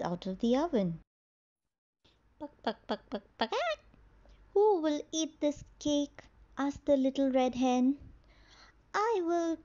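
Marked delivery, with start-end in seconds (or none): 2.4-6.2 clucking hen. Acted. Hen asks about cake. 8.9-9.6 eager cat. Acted. Cat eagerly claims cake.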